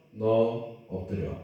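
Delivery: a quietly menacing father; stern parent. Retold or imitated. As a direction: parent with the rod telling child to bend over, low and slow. Parent raises the rod.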